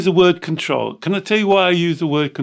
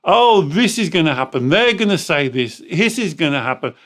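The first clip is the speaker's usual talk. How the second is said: put-on catastrophizing inner child; 5 dB louder than usual. Inner child warning of a nightmare, hammed-up alarm. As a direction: play up the disaster, stretching the big words.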